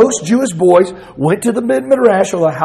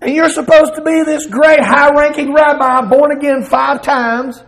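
Jesus rebuking; 7 semitones higher than usual. Acted. Jesus voiced rebuking Nicodemus.

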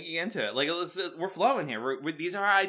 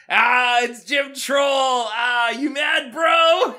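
much higher and louder, slow, jeering; taunting crowd shout; 8 semitones higher, 8 dB louder, much slower than usual. Retold or imitated. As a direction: drag out the taunt to mock him. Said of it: "everyone's like".

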